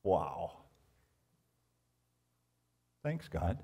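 low, soft awed thanks; hushed awe. Real, not acted. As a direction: breathe a quiet thank you.

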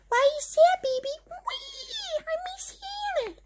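very high little baby voice, mock sad; tiny baby voice. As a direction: play the baby missing Hannah.

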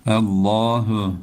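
"Allahu" with a thick, full lam, drawn out slowly; tajweed reading. Shown as a demonstration. Teacher models "Allahu" for students, thick lam, slow. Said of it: "Güzel okuyalım".

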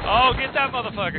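high, loud excited yell; excited shout. Real, not acted. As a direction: yell it out, pumped up. Final fight in shooter game.

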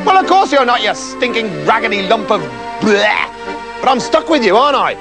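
mildly disgusted, bratty insult; disgusted princess. Acted. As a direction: spit out insults at your beggar husband. Princess insults her beggar husband.

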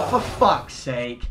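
low, soft, slow grumble; deflated exasperation. Real, not acted. Player let down by Toby's pick, slow low exasperation. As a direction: drag it out wearily. Another bad pack.

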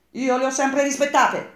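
stung engineer snapping loudly; snapping back. Acted. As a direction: bite back, offended. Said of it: "scattò l'ingegnere".

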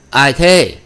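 loud, high "who's there" call; startled challenge. A narrator's reading. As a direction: challenge an intruder, alarmed. Startled man challenges noise in dark.

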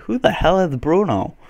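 high-pitched mock bafflement; baffled players' reaction. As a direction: imitate strangers asking in disbelief. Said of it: "people will be like".

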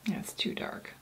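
soft unvoiced whisper. Real, not acted. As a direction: whisper it so nobody wakes. Whispering at night by camp.

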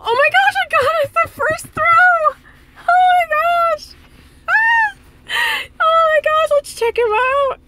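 very high, thrilled squeals; breathless high-pitched joy; 1 excited squeal. Real, not acted. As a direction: shriek with delight at the catch. Catching a rare Dragonite, squealing.

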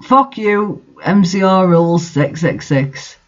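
plain reading voice

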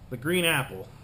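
normal talking voice

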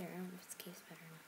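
fast, hushed whisper. Real, not acted. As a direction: whisper it quickly so nothing hears. Whispering near spider.

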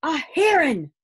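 loud, high cry of warning; alarmed shout. A narrator's reading. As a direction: shout the danger out urgently.

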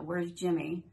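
normal talking voice

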